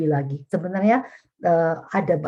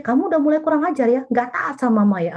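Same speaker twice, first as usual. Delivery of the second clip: high, fast mock scolding of a teen; scolding parent; 8 semitones higher than usual. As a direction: snap at the child like an offended mother. Parent scolding teenager child. (omelan ibu yang tinggi dan cepat)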